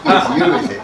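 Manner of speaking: loud laughing mock accusation; mock accusing, laughing. Former staff confronting him at awards, loud laughing accusation. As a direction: shout it through laughter.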